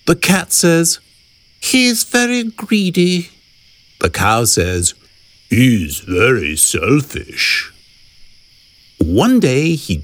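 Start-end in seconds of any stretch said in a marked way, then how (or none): 1.6-3.3 high, drawn-level cat voice; cat character. A narrator's reading. Cat complains about the greedy hippo, high cat voice. 5.5-7.7 low, slow cow voice; cow character. A narrator's reading. Cow complains about the selfish hippo, low slow cow voice.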